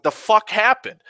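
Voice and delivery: high, loud baffled question; startled outburst, imitated. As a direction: imitate a friend blurting out in shock. Josh's startled reaction, retold.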